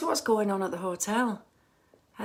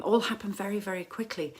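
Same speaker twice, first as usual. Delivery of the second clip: ordinary delivery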